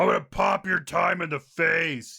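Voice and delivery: hyped macho ad voice; monster truck ad. Acted. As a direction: sell it like a rally ad. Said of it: "talk like monster truck rally commercials".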